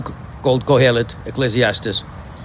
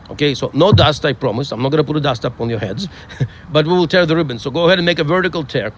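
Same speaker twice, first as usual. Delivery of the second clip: ordinary talk, nothing marked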